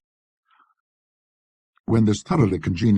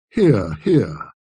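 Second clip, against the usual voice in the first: mock applause, drily ironic; lightly ironic collector; ironic hear hear; 6 dB louder than usual. A narrator's reading. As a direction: cheer him with dry irony. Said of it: "applauding ironically".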